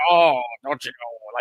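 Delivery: slow, drawn-out sleepy mumble imitation; half-asleep voice. Retelling his sleepy reply to the late call, half-asleep voice. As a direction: imitate a man barely awake on the phone. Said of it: "half the sleep voice".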